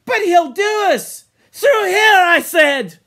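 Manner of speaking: put-on high, very loud boy's yell; urgent shout. Hunter shouting to the others mid-chase, urgent yell. Boys yelling mid-hunt.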